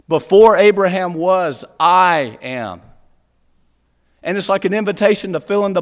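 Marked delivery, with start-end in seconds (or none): 0.1-2.8 loud proclamation with weight on "I"; emphatic declaration. Shown as a demonstration. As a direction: declare it loudly, landing firmly on "I am". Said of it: "it's there for emphasis".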